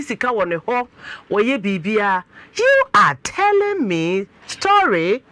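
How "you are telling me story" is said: slow, high, drawn-out challenge; loud disbelief. Real, not acted. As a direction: stretch out the question loudly, high in pitch. Host reacts to guest's account.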